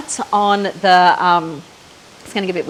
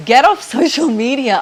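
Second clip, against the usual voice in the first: imitating husband; 5 semitones higher, 5 dB louder than usual. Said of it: "he goes".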